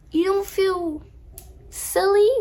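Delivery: high, pointed "silly?"; mock-incredulous jab; mocking retort. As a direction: needle him about feeling silly.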